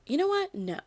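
high-pitched rise into a firm no; retold defiant interjection. Podcast host interrupts the audience's rumours, retold defiantly. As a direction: imitate someone putting their foot down. Retold character cuts in firmly.

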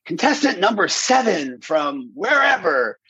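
high, drawn-out stage introduction; contest announcer. Retold or imitated. Announcer introducing a contestant to the crowd, mock MC call. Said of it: "they would say".

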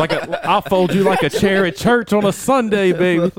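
loud, slow mock threat through laughter; laughing trash talk. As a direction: crack up while delivering a playful threat. Cracking up at own trash talk.

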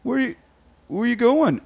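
high-pitched mock whine; worried boyfriend. Boyfriend as his girlfriend walks out, worried. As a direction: ask it high, imitating a needy boyfriend. Retold boyfriend as she leaves.

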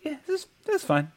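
high, fast, offhand; mock shrug. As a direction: toss it off breezily. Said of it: "when my wife asked me".

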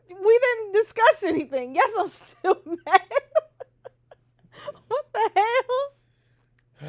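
loud, high exasperated outburst. Real, not acted. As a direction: burst out in laughing exasperation. Exasperated reply, laughing.

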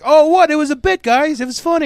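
high, loud, defensive mock whine; whiny excuse. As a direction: play the caught wrestler brushing it off. Wrestler excusing himself, quoted.

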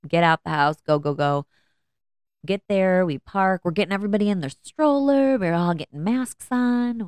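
usual speaking voice

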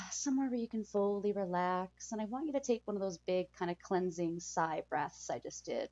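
calm soothing guide through breathing; guided meditation. Acted.

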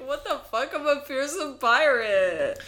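mildly mock-indignant pirate; fearsome pirate. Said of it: "I was like".

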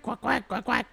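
high squawking quacks; duck voice. Acted.

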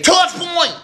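high, ringing shout of the call. Retold or imitated. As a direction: shout it out like a rallying cry. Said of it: "and say".

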